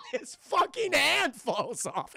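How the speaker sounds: high, slow, cracked-up repeat; laughing disbelief. Real, not acted. Storyteller cracks up at the gory twist, high laughing delivery.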